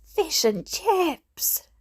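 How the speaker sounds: slow, high sing-song cheer; child chanting fish and chips victoriously; drawn-out cheer. A narrator's reading. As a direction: stretch it out in triumph. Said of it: "I yelled".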